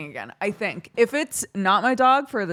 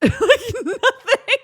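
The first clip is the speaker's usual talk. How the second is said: high, loud laughing words; helpless laughter; 14 semitones higher, 8 dB louder, slower than usual. Real, not acted. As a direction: squeeze the words out through laughter. Cracks up at absurd story.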